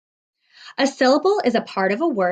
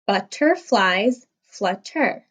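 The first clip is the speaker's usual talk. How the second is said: slow, Butterflies and flutter broken into beats; slow syllable count for children; much slower than usual. Shown as a demonstration. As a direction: spell out every syllable slowly. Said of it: "Let's count the syllables in this line together".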